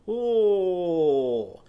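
long, loud "oh" sliding down from high; drawn-out "oh". Shown as a demonstration. Teacher showing children Lizzie Lamb's lazy nap, long sighed oh. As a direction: let out a big falling "oooh". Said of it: "Put your head on your hands and go".

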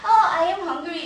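hammed-up hungry snake; voice matched to expression. Shown as a demonstration. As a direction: play the snake big, drawing out the hunger. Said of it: "이 표정이랑 지금 전혀 안 어울리는 목소리니까".

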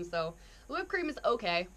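ordinary talk

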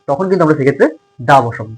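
ordinary talk, nothing marked

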